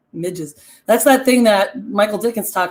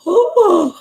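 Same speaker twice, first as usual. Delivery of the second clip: sharp gasp of mock horror, high; dramatic gasp; 10 semitones higher, 7 dB louder than usual. Telling a ghost story to viewers, gasping in mock horror. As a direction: gasp as if the story just shocked you. Shocked gasp at devil's hooves.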